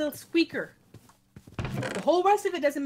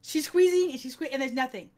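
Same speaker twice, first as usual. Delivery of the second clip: ordinary talk, nothing marked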